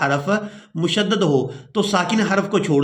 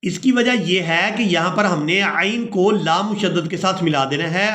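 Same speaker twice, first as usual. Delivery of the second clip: ordinary talk, nothing marked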